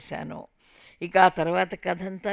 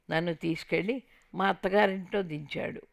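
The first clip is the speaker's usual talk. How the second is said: plain reading voice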